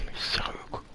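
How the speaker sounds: breathy whisper under his breath; exasperated whisper. Real, not acted. Player annoyed at stuck game, exasperated whisper. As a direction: let the frustration leak out in a whisper.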